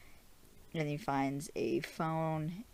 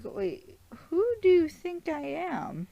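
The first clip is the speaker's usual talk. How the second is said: much higher, louder confused cry; baffled, as if he's not who she thinks; confused man on the phone, voice jumping up; bewildered Steven; 7 dB louder than usual. Retold or imitated.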